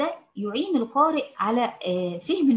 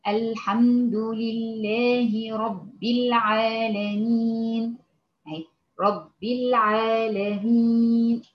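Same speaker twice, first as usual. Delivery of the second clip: slow, unhurried Quran recitation, verse by verse; slow measured recitation; much slower than usual. Shown as a demonstration. Teacher showing students slow careful recitation. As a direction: recite calmly, one verse at a time. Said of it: "تلاقي أخت مثلًا تقرأ عليك القرآن".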